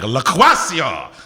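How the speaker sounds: loud, drawn-out name announcement; showy shout. Pretend. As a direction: belt the name out, stretching it. Imagined rival name, called out.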